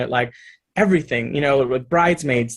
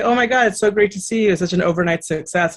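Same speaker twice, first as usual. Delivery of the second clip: gushing fans; 6 semitones higher than usual. Retold or imitated. People gushing in story.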